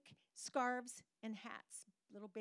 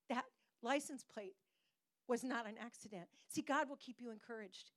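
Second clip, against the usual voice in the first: usual speaking voice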